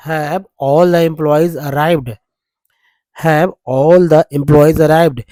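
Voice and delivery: slow, loud, word by word: "Have all the employees arrived?"; each word read out slowly; slow dictation. Shown as a demonstration. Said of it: "यहाँ से देखिए लेते हैं". (धीमे और साफ़ पढ़ा हुआ प्रश्न)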